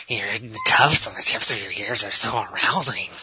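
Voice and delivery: lightly creepy, leering nerd; creepy basement nerd. Pretend. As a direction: leer over the words like a creepy nerd. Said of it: "that's the creepy basement nerd".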